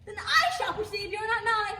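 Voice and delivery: loud resolve. Acted. Hermia's resolute exit cry.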